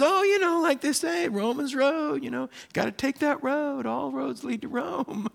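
eager churchy guy. Retold or imitated. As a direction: play the overeager guy proudly reciting a slogan. Same man voiced again.

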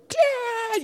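put-on high squeal of a kid pinned while wrestling; mock child yelp for Dad; child's panicked cry. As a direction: shriek Dad like a trapped little boy. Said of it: "he'd start going".

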